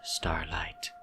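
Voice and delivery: soft menacing whisper. Acted. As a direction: breathe his name like a secret. Villain whispers his name.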